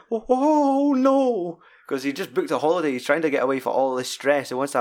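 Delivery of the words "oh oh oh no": high, panicky oh oh oh no in mock fear; panicked whimper. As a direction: imitate a man suddenly stabbed with a syringe, much higher and louder. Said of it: "Martin's like going".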